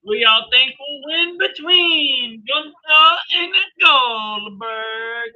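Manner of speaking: high, loud, drawn-out announcer question in an Australian-free showman style. Acted. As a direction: stretch it slowly like a ring announcer.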